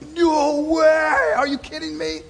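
amazed viewer at the twist; stunned disbelief. Retold or imitated. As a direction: react as if the ending floored you. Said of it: "you're like".

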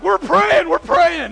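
very high, very loud insistent cry; hammed-up terrified kids insisting; kids yelling. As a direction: shout like scared teenagers. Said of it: "all the kids are like".

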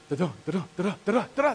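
rapid rhythmic da-da imitation; imitated sound. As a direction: mimic an approaching noise in quick double beats. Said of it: "all of a sudden I hear".

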